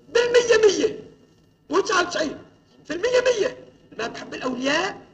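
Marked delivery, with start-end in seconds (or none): 0.1-3.6 loud, high, hammering each word; pounding preacher's stress; emphatic insistence. Real, not acted. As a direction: drive it home from the pulpit. Preacher insists hundred percent.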